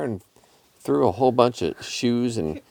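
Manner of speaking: ordinary delivery, nothing marked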